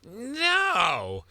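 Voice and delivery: high, drawn-out bully voice; knife-toting bully. Acted. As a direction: lay down the law like a cartoon bully. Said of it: "That's how they talk".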